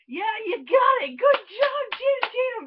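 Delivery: very high, loud mock cheer. Viewer congratulating Channing Tatum for finally getting it, shouted cheer. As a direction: whoop encouragement like a proud supporter.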